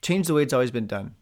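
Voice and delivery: ordinary delivery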